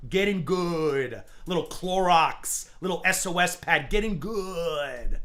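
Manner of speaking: slow, drawn-out mock instruction; slowly mocking a rival, telling him to wash. Real, not acted.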